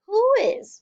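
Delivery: ordinary delivery, nothing marked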